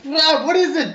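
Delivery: startled high yelp; spooked. Acted. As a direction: jump in fright, high and loud. Spooked character yelps.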